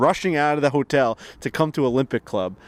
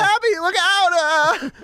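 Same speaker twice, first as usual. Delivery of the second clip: high wooden "look out" cry; bad acting; 3 dB louder, slower than usual. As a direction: overact a stiff warning. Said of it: "She goes".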